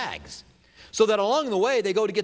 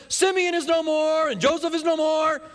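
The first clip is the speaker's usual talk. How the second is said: dramatic lament; 8 semitones higher than usual. Retold or imitated. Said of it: "Jacob says".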